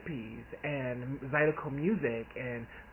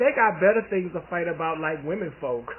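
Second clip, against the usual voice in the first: aunt's retort; 3 semitones higher, 7 dB louder than usual. Retold or imitated. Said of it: "she said".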